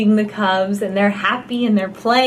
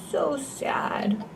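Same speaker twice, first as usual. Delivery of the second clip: hushed whisper; a sad little whisper; 9 dB quieter than usual. Acted. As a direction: breathe it softly to the child. Sad chimp, hushed aside.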